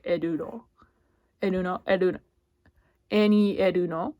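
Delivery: "Edu no" drilled in Japanese sounds; Japanese-style name. Shown as a demonstration. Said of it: "Japanese is like".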